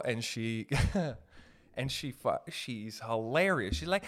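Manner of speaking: ordinary talk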